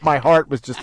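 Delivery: ordinary delivery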